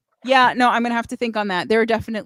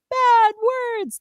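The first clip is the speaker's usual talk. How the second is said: put-on high little girl voice; lightly voiced little girl, high; child voice; 13 semitones higher, 3 dB louder than usual. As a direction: say it like a small child.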